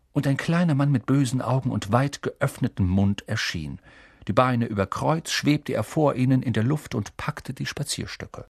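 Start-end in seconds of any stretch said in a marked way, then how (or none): none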